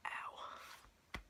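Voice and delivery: a soft pained ow after a hiccup. Real, not acted. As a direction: wince from a hiccup, barely voiced. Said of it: "I just hiccuped and it hurt".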